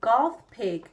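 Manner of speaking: ordinary delivery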